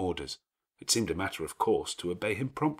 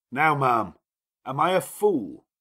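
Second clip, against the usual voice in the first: louder, commanding gentleman's voice; a lordly host giving orders at ease; gruff master of the house; 7 semitones higher, 8 dB louder, slower than usual. Acted. Rochester presses Jane.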